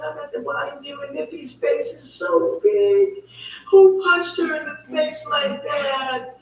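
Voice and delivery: imitating a distraught cousin, high-pitched; high retold wail, stretching out the shocked words; retold distressed cry. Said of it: "she was screaming and crying".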